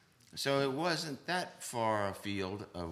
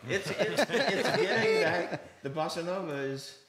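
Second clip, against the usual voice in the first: higher, louder talk through laughter; laughing; 7 semitones higher, 4 dB louder than usual. Real, not acted. Drummer laughing after the host praises him. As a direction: laugh while getting back on track. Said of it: "He knows his stuff".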